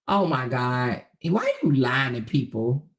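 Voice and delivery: ordinary delivery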